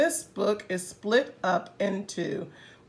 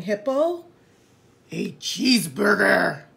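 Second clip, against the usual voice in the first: hippo voice; 4 semitones lower than usual. Acted. Hippo character voice.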